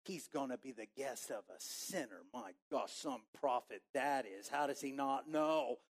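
soft disapproving crowd mutter. Pretend. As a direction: gossip under your breath about Jesus. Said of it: "That's part of the muttering".

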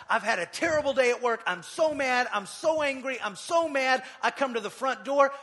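high, loud mock rant about a bad day; furious venting at the front door; angry vent. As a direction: rant like a fed-up spouse after work. Acting out an angry spouse coming home.

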